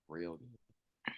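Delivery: whispered savoring. Real, not acted. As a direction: whisper it with relish. Eating cannoli filling.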